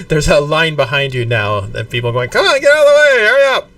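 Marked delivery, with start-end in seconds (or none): 2.3-3.7 high, loud impatient heckling; impatient crowd yell. Acted. People in the line yell at the players to move, loud impatient shout. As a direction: bark at the kids to move along.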